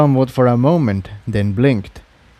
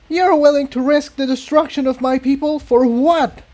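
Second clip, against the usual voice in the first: much higher furious demand; angry. A narrator's reading. Incendia confronts Tiptoe, high angry voice. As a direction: hurl the question at her.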